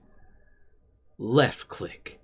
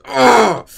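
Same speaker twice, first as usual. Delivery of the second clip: disgusted grunt; 10 semitones higher, 9 dB louder than usual. Acted. Reacting to veiny arms.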